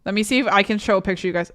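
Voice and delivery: normal talking voice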